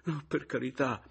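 high, pleading protest; anguished, high-pitched entreaty; imploring. A narrator's reading. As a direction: beg them desperately. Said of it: "scongiurò Pomino".

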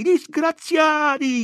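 high, drawn-out loud cry; outraged shouting. A narrator's reading. Women crying out at the guards, high drawn-out shouting. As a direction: shout it out in reproach, high and long.

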